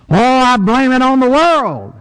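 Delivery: mocking the person who blames the world; mock excuse.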